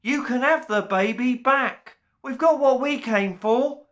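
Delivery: high little voice, still loud; calling out. A narrator's reading. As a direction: hand the baby back with a shout. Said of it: "Rhys William called out".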